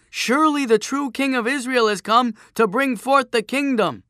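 high, loud ringing proclamation; shout. A narrator's reading. Woman in the crowd hailing Joshua as king, shouting high. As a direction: cry it out to the whole crowd. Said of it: "one woman shouted".

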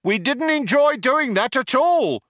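the other cop shouting. A narrator's reading. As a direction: shout it apologetically. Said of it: "shouted the other cop".

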